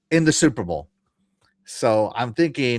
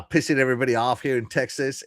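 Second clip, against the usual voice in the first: ordinary delivery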